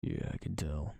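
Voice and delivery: low, dry deadpan; dry demon reply. Acted. Demon clerk dryly answering the newcomer, low and deadpan. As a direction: say it flat and unimpressed, pitched low. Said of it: "he says".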